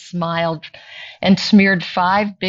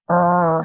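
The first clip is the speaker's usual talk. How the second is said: bear growl. Acted. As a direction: give a small bear growl. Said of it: "he growled".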